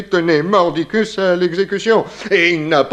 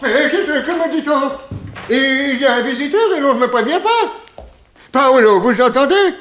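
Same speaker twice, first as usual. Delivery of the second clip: put-on fussy old boarder; 7 semitones higher than usual. Old boarder bursts in fussing.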